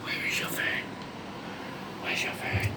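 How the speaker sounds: soft unvoiced whisper to the bird. Owner whispering to lorikeet about its friend. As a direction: hush it conspiratorially.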